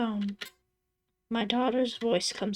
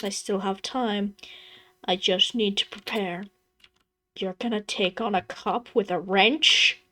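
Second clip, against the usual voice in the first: ordinary delivery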